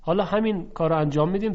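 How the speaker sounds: normal talking voice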